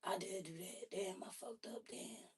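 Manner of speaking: hushed, almost under the breath; quiet self-reproach. Retold or imitated. Facing himself in the mirror, admitting his mistake: hushed. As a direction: say it softly to yourself in the mirror. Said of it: "look at yourself and be like".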